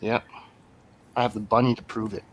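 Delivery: usual speaking voice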